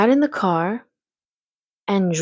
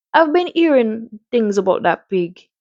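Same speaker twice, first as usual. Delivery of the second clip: put-on Caribbean-accented doctor; 3 semitones higher than usual. Doctor chatting about the farm, in a Caribbean accent. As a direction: do your best playful Caribbean accent. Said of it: "I'm gonna give him a Caribbean accent".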